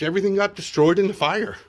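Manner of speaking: usual speaking voice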